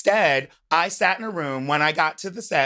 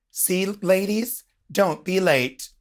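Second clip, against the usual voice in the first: mock stern announcement; 2 semitones higher than usual. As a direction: play it like a mock host making an example. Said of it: "they're gonna use this as an example".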